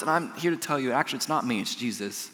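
ordinary talk, nothing marked

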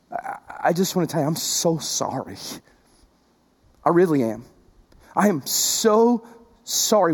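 sincere apology. Real, not acted. Pastor apologizes to hurting listeners.

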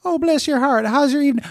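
high, sugary Southern lady voice; Southern fried lady. Retold or imitated. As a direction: gush like a sweet Southern waitress. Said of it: "this kind of Southern fried lady".

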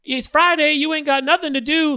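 high-pitched Friday-movie voice; Chris Tucker impression. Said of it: "Or if he was like".

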